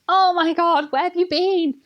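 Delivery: high-pitched mock alarm; exclaiming dad. Imitating dad's alarm on coming home.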